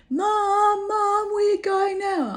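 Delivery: high, loud, drawn-out call of Mum; cheeky lad calling after her on the dance floor; young man calling. Retold or imitated. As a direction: sing it out slowly and high. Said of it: "lad calling her Mum".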